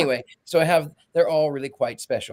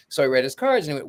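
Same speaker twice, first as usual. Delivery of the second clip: normal talking voice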